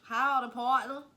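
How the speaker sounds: put-on country accent. Greeting a stranger in a hammed-up cowboy voice. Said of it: "Country, country as fuck".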